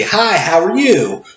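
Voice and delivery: cheery cashier greeting. Shown as a demonstration. Cashier greeting customers, demo.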